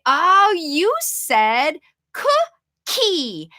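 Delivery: very slow, clear parent model. Shown as a demonstration. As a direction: stretch it out so the child hears every sound. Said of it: "say it slowly and a little bit more loudly".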